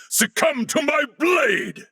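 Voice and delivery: battle shout. Acted. Knight striking an enemy, high shouted challenge. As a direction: roar the threat as you strike.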